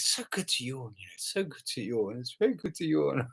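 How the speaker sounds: softly imitated encouraging old lady. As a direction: lightly mimic her gentle coaxing to yawn. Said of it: "she encouraged us".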